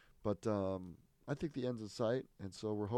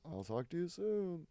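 higher, slow, syrupy sign-off; mock parole officer; 8 semitones higher, 3 dB louder, slower than usual. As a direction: drag out a sweet goodbye. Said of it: "the parole officer's like".

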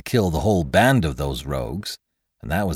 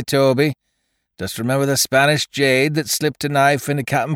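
higher, louder pirate crewman warning his mate; rising, excited warning that drops away on the oath; tipsy gunner; 5 semitones higher, 4 dB louder than usual. A narrator's reading. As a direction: needle the captain like a drunken sea-dog. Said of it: "spoke up Gunner Basil with a hiccup".

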